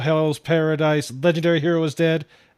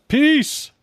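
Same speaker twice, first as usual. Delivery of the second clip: mock sign-off; 6 dB louder than usual. Mock signing off.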